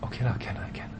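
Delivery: breathy half-voiced okay lah; reluctant whisper. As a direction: mutter the grudging yes. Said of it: "Then you".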